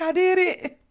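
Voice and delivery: high, loud triumph; proud excited answer. Retold or imitated. The girl announces her parasail, high and proud.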